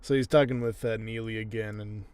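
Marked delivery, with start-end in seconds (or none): none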